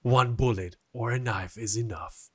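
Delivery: lightly voiced movie trailer narrator. Shown as a demonstration. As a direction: epic trailer announcer, toned down. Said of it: "let me do the trailer voice".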